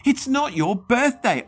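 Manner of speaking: very high, loud outraged scream; top-of-lungs shriek. Acted. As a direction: scream at the ghost. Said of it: "I shrieked at the top of my lungs".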